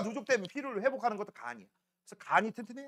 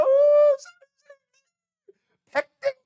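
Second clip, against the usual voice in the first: very high sobbing schoolgirl imitation; mock crying; 12 dB louder, faster than usual. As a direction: wail for the teacher in mock tears. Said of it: "막 울어". (우는 여학생 흉내 낸 높은 목소리)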